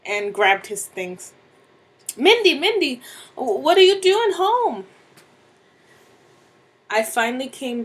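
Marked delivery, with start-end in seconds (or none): 2.2-4.9 high, loud, stammering alarm; startled cry, pitched way up; panicked husband. Acted. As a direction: caught out and blurting in a panic. Husband caught in bed, startled.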